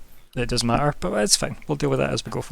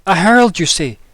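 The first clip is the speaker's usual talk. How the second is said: put-on higher and louder, thrilled old villager; eager old man; 5 semitones higher, 6 dB louder than usual. Old man greets the gooey herald, eager and loud. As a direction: gush over the herald's wonderful news.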